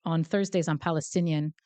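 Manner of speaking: ordinary talk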